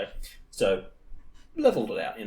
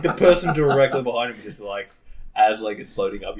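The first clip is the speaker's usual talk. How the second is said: ordinary delivery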